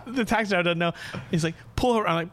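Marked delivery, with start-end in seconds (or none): 1.7-2.1 high-pitched repeated order; urgent call. Acted. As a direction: insist that the driver stop. Friend repeats order to taxi.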